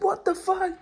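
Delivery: high falsetto mock scream; Robin Williams scream. Storyteller likening his panic to a movie, screaming high. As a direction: squeal it in comic alarm. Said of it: "screaming like Robin Williams and all that".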